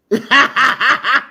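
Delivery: high burst of laughter. Real, not acted. Man cracks up at his own brag, laughing. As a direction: crack up at your own boast.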